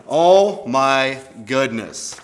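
loud, very slow, each word stretched; exasperated dismay. Acted. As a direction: draw it out in mock exasperation. Exasperated after silly sounds.

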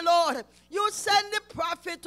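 usual speaking voice

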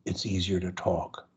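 soft and low; calm, quiet voice. Shown as a demonstration. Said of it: "Slow down".

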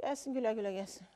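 weary murmur. Real, not acted. Exhausted, defeated guest.

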